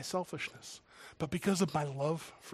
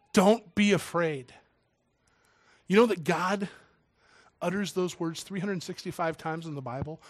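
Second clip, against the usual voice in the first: ordinary talk, nothing marked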